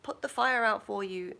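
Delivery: high, urgent servant cry of master; a frantic servant alerting his master; voiced panicky servant. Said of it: "And the servant goes".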